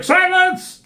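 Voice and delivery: very high, loud mock-judge shriek; high-pitched mock courtroom yell; shouted command. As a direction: cry out for order in the court, hammed up. Retold court figure silencing the others.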